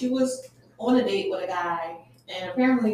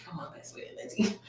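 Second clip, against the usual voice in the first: whispering; 8 dB quieter than usual. Real, not acted. Aside whispered under breath.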